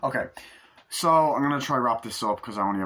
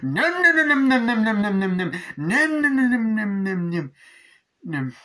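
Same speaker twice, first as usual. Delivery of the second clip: high, loud revving noise, dying away; chainsaw imitation; 12 semitones higher, 6 dB louder than usual. Father revving a chainsaw at the mob, loud high imitated motor. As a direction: rev hard in bursts, then sputter out.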